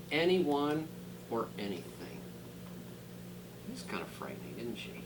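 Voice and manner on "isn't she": plain reading voice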